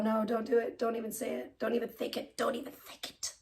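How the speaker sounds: very soft, fading to a whisper; under the breath, almost voiceless by the end; hushed warning. Real, not acted. Warns self off a creepy thought.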